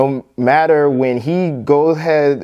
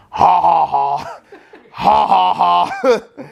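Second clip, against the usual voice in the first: evil villain cackle rising high; 6 semitones higher, much slower than usual. Retold or imitated. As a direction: cackle like a cartoon villain echoing down a hallway. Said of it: "I just start laughing like [name]".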